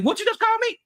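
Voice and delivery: high squeaky mock outrage; mock indignant lefty. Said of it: "They be like".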